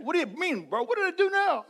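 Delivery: a touch of a whining little brother. Retold or imitated. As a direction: protest like an innocent kid brother. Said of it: "like a little brother would say".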